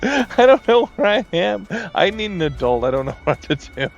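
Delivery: laughing, high and loud. Real, not acted. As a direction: laugh at being lost. Laughs at being lost.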